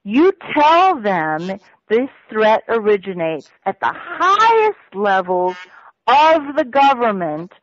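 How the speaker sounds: slow, each word weighed; slow emphatic dictation of a threat. Retold or imitated. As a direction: spell out the order word by word. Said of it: "He was emphatic".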